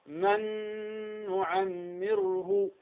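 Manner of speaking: "من نعمره" with the ن held as a long nasal hum; Ghunna-Demo. Shown as a demonstration. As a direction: merge "من نعمره" slowly, humming the doubled ن through the nose. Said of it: "الغنة هو صوت بمقدار حركتين".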